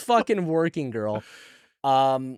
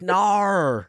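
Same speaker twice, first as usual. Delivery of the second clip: put-on Aussie accent; 4 semitones higher than usual. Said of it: "That's not an Aussie accent".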